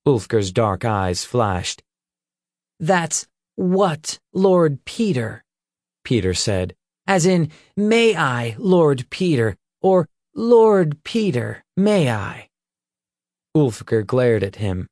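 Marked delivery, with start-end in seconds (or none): 2.8-12.5 mocking young captor. Peter mocks humbled enemy.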